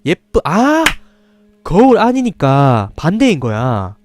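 excited realization. Real, not acted. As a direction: burst out with the answer. Realizes reversed text.

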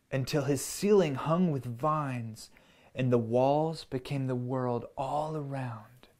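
put-on gentle storybook read-aloud for kids; reading to children. A narrator's reading. Parent reading a picture book to children, gentle storyteller voice. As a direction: tell it like a bedtime story.

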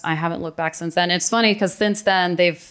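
ordinary talk, nothing marked

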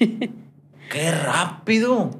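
high, very drawn-out amazement; amazed. Real, not acted. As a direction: stretch it out slowly in astonishment. Host amazed a year has passed. (asombrado, alargando mucho las palabras)

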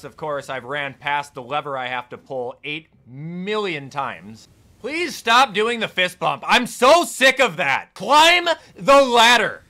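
ordinary talk, nothing marked

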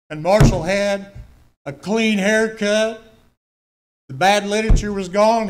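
slow, loud, punched testimony; emphatic preaching. Real, not acted. Preacher telling the church about his friend, loud and slow. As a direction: drive each word home to the congregation.

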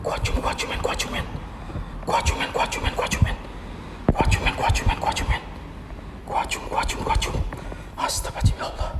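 soft frightened whisper, chanting under the breath; hushed whisper chant; whispered prayer. Real, not acted. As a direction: murmur protective words so nothing hears. Whispered prayers in haunted house.

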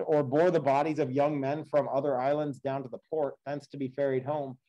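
steady, measured read-aloud of an epic; story narration. A narrator's reading. Teacher reading the Odyssey to the class, measured storytelling.

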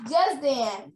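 ordinary delivery, nothing marked

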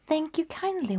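put-on high, polite little girl; girl's reply. Girl thanking the wolf, high and sweet.

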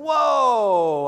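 loud, high drawn-out whoa; mock-alarmed protest, long and loud; taken aback. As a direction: balk loudly, as if backing away from the job. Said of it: "the first thing he does is".

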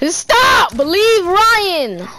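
loud, high frantic shout; yelling. Real, not acted. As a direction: yell at him to stop. Yelling at teammate.

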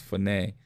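"phone" said fuh-NAY in two syllables; Greek pronunciation. Shown as a demonstration. Preacher showing how it is pronounced, "phone" said fuh-NAY. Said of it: "is pronounced phone".

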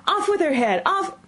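high-pitched furious shriek; shrieked royal command, cut off mid-word; screamed. A narrator's reading. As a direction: scream the order like an enraged queen.

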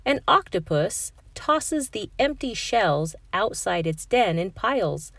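plain reading voice

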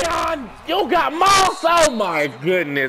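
slow, drawn-out cry, falling in pitch; dismayed wail. Real, not acted. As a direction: wail at your beaten defender. Wailing at a player beaten deep.

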